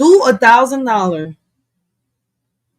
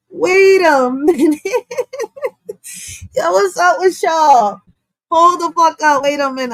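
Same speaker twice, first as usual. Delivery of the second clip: high, laughing wait a minute; cracking up in disbelief at the comment; 8 semitones higher than usual. Real, not acted. Streamer laughs at a chat comment, laughing disbelief.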